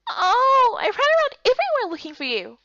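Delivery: high, whiny mock complaint; hammed-up pouting girl; pretend huff. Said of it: "pretended to huff".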